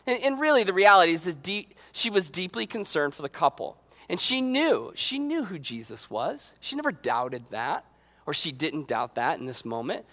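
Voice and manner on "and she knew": usual speaking voice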